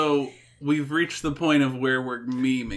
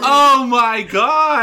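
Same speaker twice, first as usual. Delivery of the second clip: excited; 8 semitones higher, 8 dB louder, much slower than usual. Real, not acted. Excited reaction to synced snacks.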